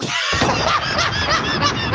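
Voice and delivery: high, wheezy burst of laughter; helpless high-pitched cackle. Real, not acted. Laughs at retold Southern remark.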